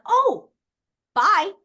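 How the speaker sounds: high, loud mock-cheery goodbye; sarcastic bye. Brushing off a stubborn person, high sarcastic bye. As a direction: toss off a bright high farewell. Dismissing someone who refuses.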